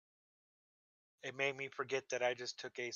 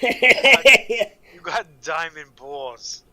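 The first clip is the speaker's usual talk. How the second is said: slow, loud drawn-out declaration; emphatic; 4 semitones higher, 19 dB louder, much slower than usual. Acted. Friend drags out the joke to his buddies, slow and loud. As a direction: stretch every word out loud and slow.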